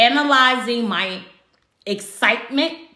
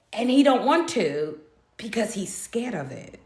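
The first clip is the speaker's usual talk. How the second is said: whisper; 3 dB quieter than usual. Real, not acted. Aside about his fear.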